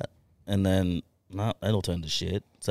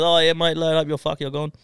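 high, loud brush-off; loud foreman voice; 9 semitones higher, 7 dB louder than usual. Retold or imitated. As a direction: boom it out like a foreman. Foreman voiced dismissing him.

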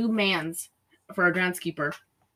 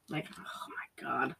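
soft breathy whisper, lower; whisper aside; 7 semitones lower, 15 dB quieter than usual. Real, not acted. Whispering an aside to her co-host about the pout. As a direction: breathe it under your voice.

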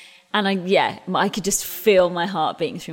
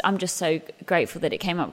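normal talking voice

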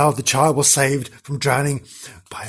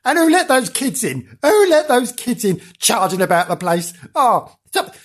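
high-pitched character voice, sulky then jumpy; sulky Janice; 8 semitones higher than usual. Acted. Janice reporting to the studio from the haunted upstairs room, high and huffy. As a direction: play a huffy ghost hunter getting rattled, pitched high.

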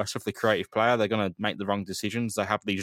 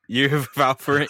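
louder, higher teasing jab; 5 semitones higher, 7 dB louder than usual. Real, not acted. Ribbing his co-host over his McGinn crush, loud teasing. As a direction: rub it in, mate.

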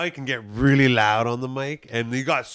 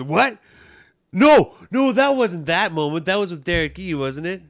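high, sudden no no; surprised correction; 7 semitones higher, 4 dB louder than usual. Retold or imitated. Friend correcting the story, high surprised protest. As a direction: jump up in pitch in disbelief. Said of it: "Bizarre goes".

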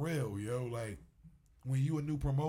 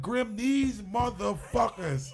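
slow, louder exasperated "these motherfuckers" to Grim; disbelief; 7 semitones higher, 5 dB louder, much slower than usual. Retold or imitated. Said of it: "I couldn't believe it".

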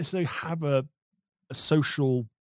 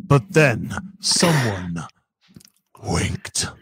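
slow, dark trailer narration; ominous trailer voice; 5 semitones lower, 5 dB louder, much slower than usual. Acted. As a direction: drag it out for suspense. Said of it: "trailer voice time".

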